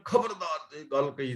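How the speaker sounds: demonstrated angry-style warning, not fully shouted; mild mock shout of warning; forceful mock warning. Shown as a demonstration. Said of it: "ਅਸੀਂ ਜ਼ੋਰ ਦੀ ਕਹਿੰਦੇ".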